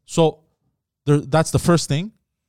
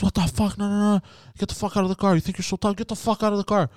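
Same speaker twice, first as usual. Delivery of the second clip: higher, aggressive demand to get out; mock angry yell; 6 semitones higher than usual. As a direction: bark at him like a hothead driver. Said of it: "He goes".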